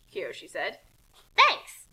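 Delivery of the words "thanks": bright thanks. Acted. Kasumi thanks Kiyoshi.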